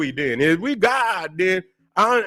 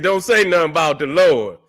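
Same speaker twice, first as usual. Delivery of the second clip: ordinary talk, nothing marked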